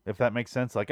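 ordinary delivery

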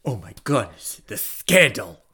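hammed-up horror, punching the last word loud; mock scandalised. Husband reacting to Lewis accepting Catholics, mock scandal. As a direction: play pretend outrage, building to a loud finish.

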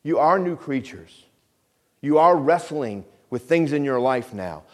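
ordinary delivery

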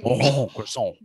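hammed-up French mode; mock French accent. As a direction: put on a silly French accent. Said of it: "get in my French mode".

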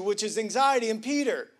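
ordinary talk, nothing marked

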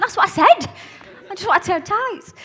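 high, loud, laughing Glaswegian; laughing Glaswegian retort. Retold or imitated. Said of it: "with a strong Glaswegian accent".

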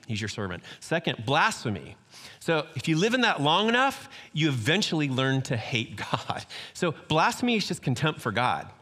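usual speaking voice

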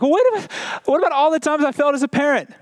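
high-pitched, worried objection; protesting objector. Acted. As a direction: voice an objector jumping in, pitch shooting up. Acting out an anxious objector's question.

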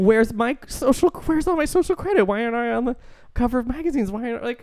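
high-pitched, put-upon whine; mock whining complaint. Mocking her craving for fame.

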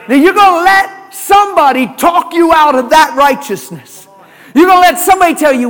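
fiery preaching cry; preaching shout. Real, not acted. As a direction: hammer the challenge at them. Preacher challenges congregation.

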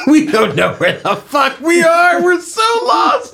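high, loud laugh through the words; laughing loudly through the lost-in-Amsterdam part; laughing hard. Real, not acted. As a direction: shout it while cracking up. Laughing panic while retelling.

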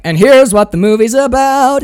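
loud, high, hammed-up proclamation; mock announcement. Mocking dumb movies that spell things out, loud announcer parody. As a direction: blare it out as obvious exposition.

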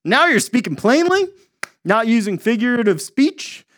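high, know-it-all voice for the disciples; smug disciples. Retold or imitated. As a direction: lift the pitch and sound pleased with yourselves. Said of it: "His disciples said".

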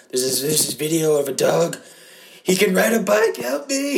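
high, loud plea for help; desperate addict. Pretend. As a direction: call your sponsor in a squeaky panic. Addict calling his sponsor.

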